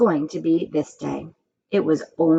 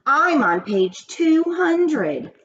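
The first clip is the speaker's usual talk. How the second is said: high, slow, louder boastful shout; 10 semitones higher, 3 dB louder, much slower than usual. A narrator's reading. Joy showing off to Todd, slow high shout. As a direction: crow it out to show off. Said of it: "Joy shouted".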